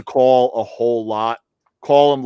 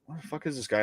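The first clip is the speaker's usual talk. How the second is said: whispered puzzled aside, much softer; hushed; 14 dB quieter, much faster than usual. Retold or imitated. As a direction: breathe it like you're hiding from the bird. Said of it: "you're like".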